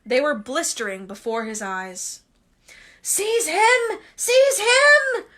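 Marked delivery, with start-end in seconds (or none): 3.0-5.2 high, loud shriek, rising. Acted. Voldemort shrieking at Quirrell, high and loud. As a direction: shriek it twice, climbing higher. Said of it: "shrieked Voldemort again".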